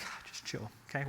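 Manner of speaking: whispered, telling a client to relax; hushed calming. Retold or imitated. To a panicking client, a whispered reassurance. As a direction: breathe it out, unvoiced and soothing. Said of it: "it's like".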